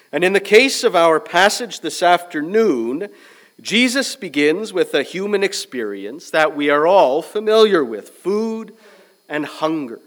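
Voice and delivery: loud, ringing pulpit delivery; full-force preaching. Acted. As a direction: preach it out to the whole congregation.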